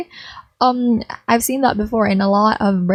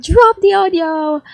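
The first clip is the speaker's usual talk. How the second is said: excited chat shout; 8 semitones higher than usual. Retold or imitated. Chat comment voiced.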